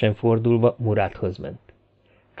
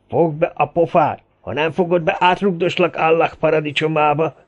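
raised, aggressive warning; snarled threat; 6 semitones higher than usual. A narrator's reading. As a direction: threaten him to shut him up. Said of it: "morogta felhúzott innyel". (dühösen fenyegetőzve)

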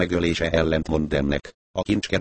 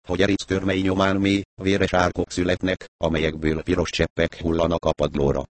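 ordinary delivery

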